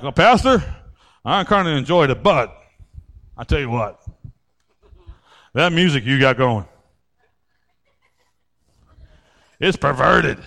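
low, prim disapproving visitor; mock churchgoer.

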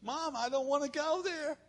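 a soft, reluctant son's whine; whiny quiet son. Retold or imitated. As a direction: mumble it like a boy who won't get up. Son whining to mom.

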